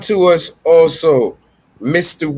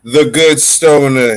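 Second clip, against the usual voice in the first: ordinary talk, nothing marked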